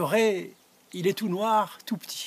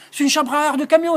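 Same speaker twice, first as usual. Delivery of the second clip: loud, high exclamation; outraged at the truck tire; loud incredulous outburst; indignant; 11 semitones higher, 10 dB louder, faster than usual. Acted. Gérard indignant at tube.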